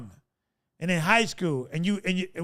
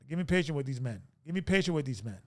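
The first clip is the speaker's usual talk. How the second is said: muttered prayer; 6 semitones lower, 7 dB quieter, faster than usual. Real, not acted. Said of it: "repeated prayer, quieter".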